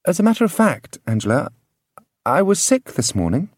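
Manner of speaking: put-on high, gentle young woman voice; Farrelyn's voice. A narrator's reading. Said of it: "introducing the matter gently".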